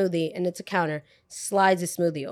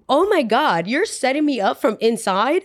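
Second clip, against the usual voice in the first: higher, sing-song incredulous outburst; outraged disbelief; 5 semitones higher, 3 dB louder than usual. Retold or imitated. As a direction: retell it as a scandalised reaction. Outraged reaction to coworker's betrayal.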